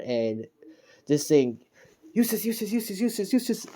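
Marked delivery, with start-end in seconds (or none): 2.1-3.7 high-pitched, rapid-fire chant; mock battle cry. Stand smashing the robot, high mock battle cry. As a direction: imitate an anime battle cry without raising the volume.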